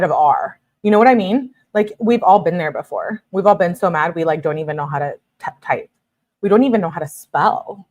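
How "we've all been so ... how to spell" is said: ordinary talk, nothing marked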